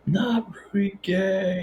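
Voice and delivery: stretched, high-pitched imitation of a scream; mock scream. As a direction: echo the horrified fan in a small high voice. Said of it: "he's just screaming".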